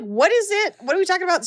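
high-pitched eager what is it; eager questioning. Retold or imitated. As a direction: squeal the questions with impatient curiosity. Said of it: "'cause I was like".

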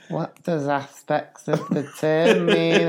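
put-on puzzled kid sounding out the phrase; puzzled pupil. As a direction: imitate a baffled schoolboy. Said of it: "he was kinda like".

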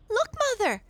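put-on very high, loud excited girl; girl crying out. A narrator's reading. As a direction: call to mother, high and thrilled. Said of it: "cried Mabel".

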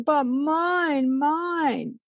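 put-on high insistent toddler whine; a little girl pleading mine. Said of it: "And Holly says".

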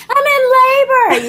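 high, loud strained cry; mock-panicked high announcement; frantic. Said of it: "And I'm like".

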